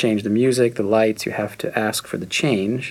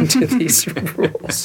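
laughing; 7 semitones higher, slower than usual. Real, not acted. Laughing while signing rules away.

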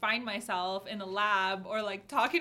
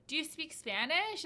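higher-pitched, friendly inquiry; polite curious question; 7 semitones higher, slower than usual. Retold or imitated. Asking Miamians with thick accents if they speak Spanish, curious.

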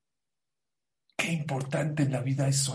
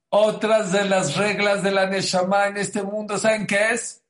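higher, louder teasing buildup; teasing reveal; 6 semitones higher, 6 dB louder than usual. Acted. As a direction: build suspense before the reveal. Teasing big reveal to audience.

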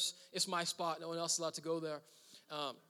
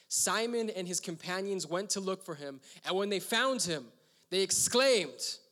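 scripture reading; 4 dB louder than usual. Acted. Pastor reading Mark to congregation, measured reading. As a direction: read the verses with weight.

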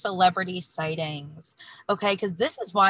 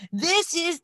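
put-on high-pitched, botched Irish accent; attempted Irish accent; 9 semitones higher than usual. Host copying the fighter's played-back quote, bad Irish accent. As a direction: try an Irish accent that gets stuck in Austrian. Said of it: "We're stuck in Schwarzenegger".

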